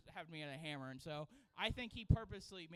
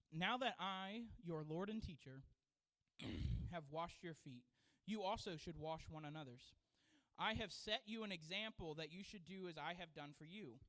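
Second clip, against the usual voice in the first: lower, soft measured Bible reading; scripture reading; 4 semitones lower, 3 dB quieter than usual. Acted. Pastor reading John to the congregation, reverent. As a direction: read the verse with reverence.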